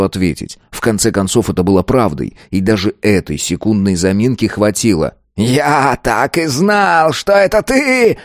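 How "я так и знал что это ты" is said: slow roar climbing very high. A narrator's reading. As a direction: draw out the accusation, voice rising to a strained peak. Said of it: "взревел старейшина". (рёв, протяжно и всё выше)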